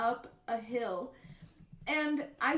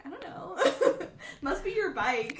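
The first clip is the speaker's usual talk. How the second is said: laughing; 6 semitones higher than usual. Retold or imitated. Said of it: "And I was like".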